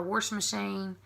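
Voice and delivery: "wash" said "worsh" in wash machine; family's warsh. Retold or imitated. Imitating what her family calls it, worsh machine. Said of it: "they say wash".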